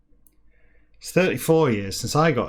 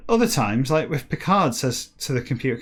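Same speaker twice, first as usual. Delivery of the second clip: usual speaking voice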